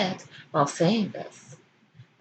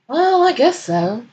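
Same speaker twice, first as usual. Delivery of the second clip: slow, higher reluctant concession; Baring giving in, slow and grudging; 7 semitones higher, 5 dB louder than usual. Acted. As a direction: drag it out grudgingly.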